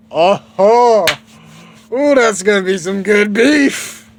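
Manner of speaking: put-on high, loud kid-hero voice, drawn out; Goku. Goku eyeing the bull as food, high and loud. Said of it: "Goku's all like".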